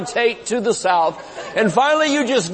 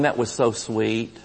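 sweet hushed churchgoer; 10 semitones lower, 8 dB quieter than usual. Retold or imitated. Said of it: "people leaving and going".

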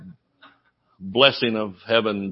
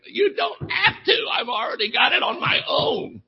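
mock crying; 10 semitones higher than usual. Child answering his father, hammed-up crying. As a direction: whine it like a crying kid. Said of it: "I'm thinking".

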